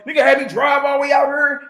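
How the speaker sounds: high, loud pretend shout; mock angry rant. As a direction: yell it like you're fed up. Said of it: "I could have got mad and been like".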